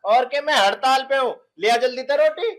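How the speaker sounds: loud, high, testy retort; irritated demand. Acted. Husband demanding roti from wife, loud and irritated. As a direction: demand it loudly and impatiently.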